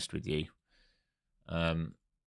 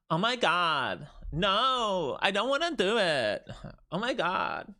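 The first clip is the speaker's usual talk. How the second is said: much higher, louder mock valley girl whine; valley girl impression; 10 semitones higher, 4 dB louder, slower than usual. Streamer voicing his trading tool as a valley girl, high mock whine. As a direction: squeal it like a spoiled LA valley girl. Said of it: "it's like a valley girl from L A".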